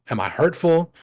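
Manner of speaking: ordinary delivery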